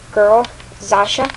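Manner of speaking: usual speaking voice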